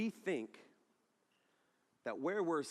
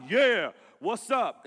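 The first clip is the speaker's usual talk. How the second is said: loud, drawn-out swaggering shout; cocky greeting; 7 semitones higher, 12 dB louder, much slower than usual. Retold or imitated. Friends proudly claim the front row, loud and cocky. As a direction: call it out slowly, high and loud.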